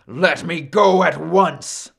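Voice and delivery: loud, imperious order; commanding. Acted. Trapped Sun orders Maui to release him, commanding. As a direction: demand release in a put-on big voice. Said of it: "Sun commanded".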